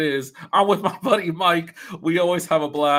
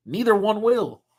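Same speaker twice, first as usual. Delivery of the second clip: ordinary talk, nothing marked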